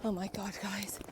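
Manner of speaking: hushed, low, barely voiced; shaken whisper. Real, not acted. Shaken rider to viewers after a scare, whispering.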